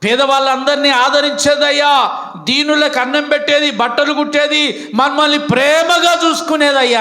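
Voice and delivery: a touch of crying as they beg the apostle; retold weeping plea. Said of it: "అందరూ ఏడ్చుకుంటూ". (ఏడుస్తూ వేడుకునే స్వరం)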